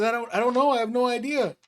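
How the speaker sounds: high, helpless I-don't-know; boy at a loss and scared; high panicky shrug in the voice; frightened teenage boy. Acted. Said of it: "He says".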